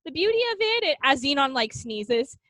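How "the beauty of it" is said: dreamy aunt. Retold or imitated. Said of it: "And she's like".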